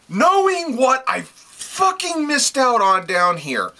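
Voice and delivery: high, drawn-out outburst; exasperated excitement. Real, not acted. Reviewer raving about comics he nearly missed, excited outburst. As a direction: stretch it out high, overwhelmed.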